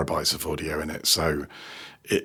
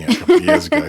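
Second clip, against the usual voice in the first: indignant squeak; 7 dB louder, much faster than usual. Real, not acted. Griping about the ended deal.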